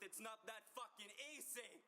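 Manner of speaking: high, climbing outburst; frustrated yell. Acted. [name] snapping back at a friend's advice, frustrated yell. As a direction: burst out in exasperation, pitch soaring. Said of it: "I'm sorry I yelled".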